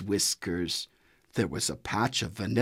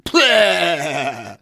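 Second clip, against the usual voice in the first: sharp high spit-out "Pah!" with disgust; spitting pah; 6 semitones higher, 7 dB louder than usual. A narrator's reading. Horse spitting out mud ice cream, disgusted spit. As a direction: spit the bad taste out with a loud pah. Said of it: "said Horse as he spit".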